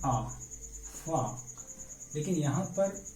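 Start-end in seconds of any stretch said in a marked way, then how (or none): 0.1-1.2 आ as an open long vowel, then फा; long "aa" sound. Shown as a demonstration. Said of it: "ए का उच्चारण है". (आ की लंबी आवाज़, फिर फा)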